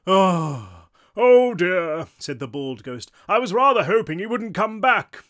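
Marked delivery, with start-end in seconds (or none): 0.1-2.1 loud, theatrical sigh of dismay; sighing English ghost. A narrator's reading. Bald ghost seeing a third cloud form, dismayed English sigh. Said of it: "both ghosts sighed". 3.3-5.2 loud, high English dismay; grand English ghost. A narrator's reading. Bald ghost dreading the third ghost's return, grand English. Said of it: "what might have been an English accent".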